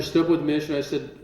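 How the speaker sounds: ordinary talk, nothing marked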